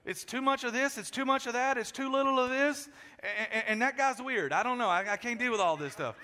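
a husband listing complaints, hammed up; mock-fussy husband rejecting the show; picky husband. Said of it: "I'm like".

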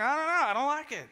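a husband listing complaints, hammed up; mock-fussy husband rejecting the show; picky husband. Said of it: "I'm like".

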